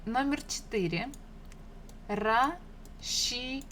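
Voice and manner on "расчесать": расчесать very slowly, in three separate syllables. Shown as a demonstration. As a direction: break расчесать apart, pausing after each syllable. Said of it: "read these words together".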